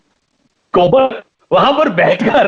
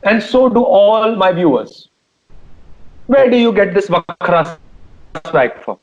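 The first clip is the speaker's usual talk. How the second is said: drawn-out, portentous anchor question; slow mock-interrogation; slower than usual. Host grills singer for his viewers, slow and portentous. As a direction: stretch each word like a grandstanding host.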